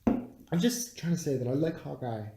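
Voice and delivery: ordinary talk, nothing marked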